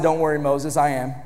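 a hint of a low, calm God; lightly voiced God. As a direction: answer Moses like a steady deity. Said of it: "I heard one preacher say it like this".

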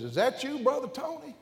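high called-out greeting; doctor hollering across the park; hollered out. Retold or imitated. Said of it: "he hollered out".

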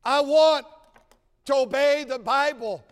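high, loud preaching crescendo; full-force preaching. Acted. Preacher urging the church to assemble, shouting the verse. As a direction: thunder the verse at the congregation.

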